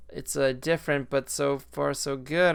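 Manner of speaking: ordinary talk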